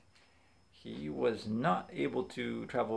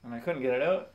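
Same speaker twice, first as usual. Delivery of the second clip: usual speaking voice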